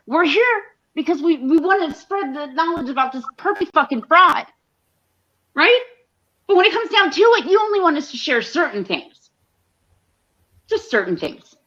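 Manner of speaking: high, heated complaint; heated rant. Real, not acted. Streamer scolding her audience, high and heated. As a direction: vent the frustration, voice climbing.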